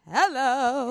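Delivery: sassy hello. Pretend. Mock Kardashian-style exclamation.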